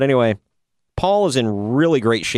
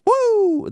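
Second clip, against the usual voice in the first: long, high-pitched "woo"; mock Flair shout. Podcaster retelling a wrestling promo, imitated high "woo". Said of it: "after Flair shouts".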